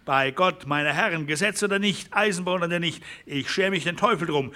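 high, fast and fierce; defiant speech. Acted. A rancher defying the railway before the men, fierce. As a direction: swear you will not be driven out.

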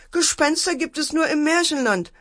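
high, firm motherly telling-off; annoyed scolding. A narrator's reading. Mother corrects children about ghosts, high annoyed lecturing. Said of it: "Mutter guckte ärgerlich".

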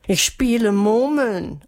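slow, high, pitiful wail; sorry-for-herself whine; plaintive. A narrator's reading. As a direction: answer miserably, drawing the words out. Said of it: "antwortete Melissa mit kläglicher Stimme".

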